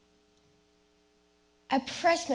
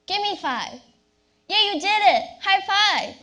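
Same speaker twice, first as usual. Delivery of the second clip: excited cheer; 7 semitones higher, 6 dB louder than usual. Acted. Opening acted high fives.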